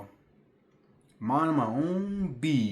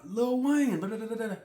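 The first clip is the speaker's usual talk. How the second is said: mock yell across the street; 11 semitones higher than usual. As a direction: call out like strangers across a street. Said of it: "yelling at me at the bus stop".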